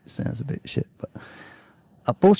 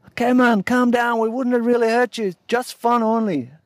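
much higher, nervous calming voice; placating local guy; 11 semitones higher than usual. Retold or imitated. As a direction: back off and soothe him.

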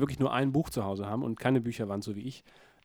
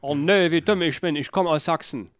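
a hint of Saxon dialect, loud and high; Saxon accent; 4 semitones higher, 8 dB louder than usual. Man pretending his Saxon accent slipped out, lightly voiced Saxon. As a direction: play the slipped-out Saxon, mock dismay. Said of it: "jetzt ist mir rausgerutscht".

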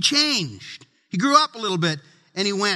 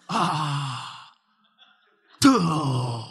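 whiny groan; 7 semitones lower than usual. Retold or imitated. Child groaning at being told to do chores. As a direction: sag into a reluctant groan.

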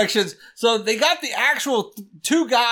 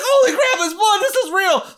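panicked shout; 10 semitones higher than usual. Retold or imitated. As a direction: yell it as the blood shows up. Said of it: "So he's like".

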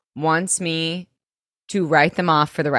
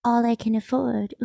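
soft, high cutesy whine; mock-pitiful little girl voice; baby voice; 5 semitones higher, 6 dB quieter than usual. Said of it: "The baby voice was out of control".

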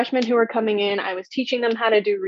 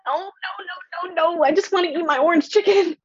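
high, half-crying mock protest; mock whine; 8 semitones higher than usual. At the restaurant, dreading mock trial, in a high mock whine. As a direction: whimper it like a kid who only wants dinner.

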